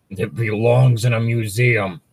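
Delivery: hammed-up hero line; Indiana Jones line. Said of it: "the line".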